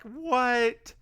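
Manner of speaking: high-pitched baffled what; a hammed-up rising squawk of disbelief; mock incredulous. Host retelling Jessica's reaction, mock incredulous. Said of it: "Just like".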